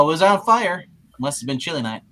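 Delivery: ordinary delivery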